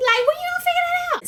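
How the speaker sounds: very high and fast; exasperated squeal. Retold or imitated. Recapper scolding the girlfriend, high and fast. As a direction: squeal it in disbelief.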